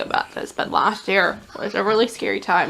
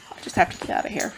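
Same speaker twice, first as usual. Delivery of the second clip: hurried whisper; 3 semitones lower, 6 dB quieter, faster than usual. Retold or imitated. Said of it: "And I was like".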